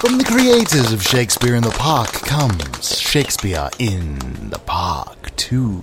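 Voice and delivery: loud, booming trailer announcer; movie trailer voice. Acted. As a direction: sell the sequel like a blockbuster trailer.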